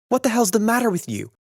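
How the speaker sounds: sharp high yell of a roommate under attack; angry shout. A narrator's reading. Stradlater yelling at Holden in a fistfight, angry shout. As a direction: bark it out high and loud.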